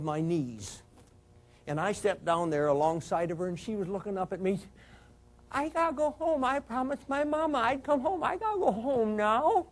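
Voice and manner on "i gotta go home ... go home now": put-on high, small child's voice, pleading; little girl's voice. Cop voicing dying Joni begging to go home, high little girl's voice. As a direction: plead like a scared little girl. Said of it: "in a little, a little girl's voice".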